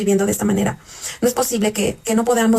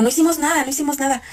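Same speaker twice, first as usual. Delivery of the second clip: high-pitched, pleading mock denial; retold protest; 6 semitones higher than usual. Wife answering shouting robbers, retold high-pitched protest.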